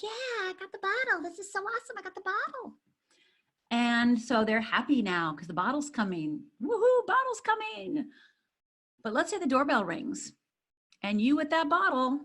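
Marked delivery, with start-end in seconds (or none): 0.0-2.8 put-on high, soft, delighted baby voice; happy baby. Coach voicing the baby who got the bottle, high delighted baby voice. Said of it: "They're like". 6.6-8.1 put-on high, slow woohoo of baby glee; mock cheer. Coach playing the baby excited that the bottle is coming, high slow cheer.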